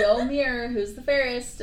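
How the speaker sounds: a slangy bored queen; mock casual queen. Queen asks mirror casually.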